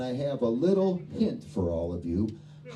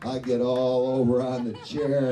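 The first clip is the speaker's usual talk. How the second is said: ordinary talk, nothing marked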